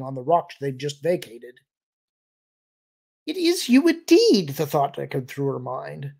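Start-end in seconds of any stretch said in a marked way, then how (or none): 3.3-4.5 high, loud alien exclamation; excited relief. A narrator's reading. Alien finds his friends alive, high excited relief. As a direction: cry it out high, thrilled and relieved. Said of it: "framed by excitement and relief".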